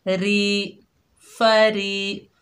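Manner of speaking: फरी held long with a thin r. Shown as a demonstration. Teacher demonstrating a madd to students, drawn-out फरी. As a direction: stretch री. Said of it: "एक अलिफ की मिकदार खींच कर पढ़ेंगे".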